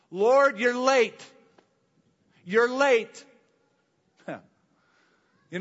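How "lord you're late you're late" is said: louder, higher and slow, a mock scolding Martha; hammed-up reproach, as Martha drawing out "Lord, you're late"; Martha's complaint. Preacher voicing Martha scolding Jesus for being late, a loud mock reproach.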